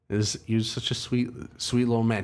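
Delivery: ordinary delivery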